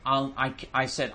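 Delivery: usual speaking voice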